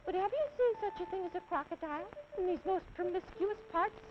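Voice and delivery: put-on very high, small child voice; elephant's child. Little elephant politely asking the snake, high child voice.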